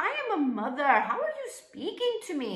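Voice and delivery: high, indignant comeback; indignant retort. Retold or imitated. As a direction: retell it as an affronted, high-pitched protest. Retells her indignant reply.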